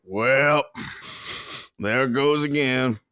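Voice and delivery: a light, slowed cowpoke twang; cowpoke drawl. Retold or imitated. Friends quoting a Red Dead cowpoke, lightly voiced twang. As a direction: drawl it out like an old cowhand. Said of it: "it's very cow, cowpoke kind of thing".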